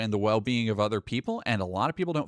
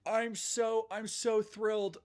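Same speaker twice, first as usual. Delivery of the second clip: sarcastic; 10 semitones higher, 8 dB quieter, slower than usual. Real, not acted. Sarcastic reaction to anti-woke ad.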